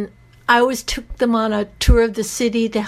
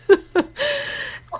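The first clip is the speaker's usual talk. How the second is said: embarrassed laugh through tears, much higher; high teary laugh; 13 semitones higher than usual. Real, not acted. Apologizing on air for crying, a high teary laugh. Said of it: "Sorry, I'm getting emotional again".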